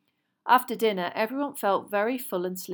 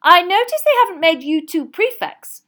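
stern mother; 11 semitones higher, 15 dB louder than usual. A narrator's reading. Missus Weasley's voice.

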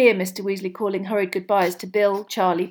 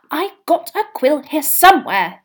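anxious Mrs Weasley; 7 semitones higher, 9 dB louder than usual. A narrator's reading.

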